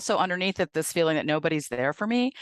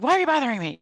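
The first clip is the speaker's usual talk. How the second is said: annoyed; 8 semitones higher, 3 dB louder, faster than usual. Retold or imitated. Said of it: "they'll act really annoyed".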